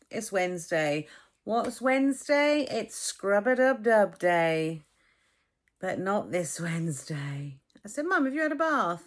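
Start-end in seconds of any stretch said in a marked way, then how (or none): 1.4-4.8 slow, louder playful announcement; sing-song announcement. Real, not acted. Joking to the camera about Mum's bath day, playful sing-song. 8.1-9.0 higher, louder retold question; exasperated question. Retelling asking her mum about the bath, exasperated.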